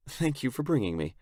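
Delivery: put-on high girlish voice, giving thanks; grateful girl. A narrator's reading. Sarah thanking him for bringing her, grateful girl.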